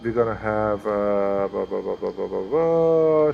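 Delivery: ordinary delivery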